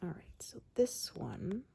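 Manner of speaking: soft whispered craft narration; ASMR whisper. Acted. Explaining the next craft step to viewers, whispered. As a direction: whisper each step as you work.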